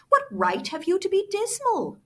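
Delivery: high, bright teasing question; gaily. Acted. Nephew teasing Scrooge back, high bright question. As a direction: toss it back playfully. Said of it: "returned the nephew gaily".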